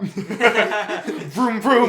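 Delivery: high, loud playful engine vroom; laughing vroom. Retold or imitated. Friends joking about Toad's car mania, laughing vroom. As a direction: rev it like a toy car through a laugh.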